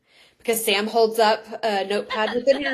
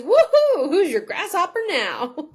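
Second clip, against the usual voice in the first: high, loud woohoo with laughter; hammed-up kid's victory whoop; triumphant gloating; 9 semitones higher, 5 dB louder than usual. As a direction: crow over your victory. [name] crows after snatching pebble.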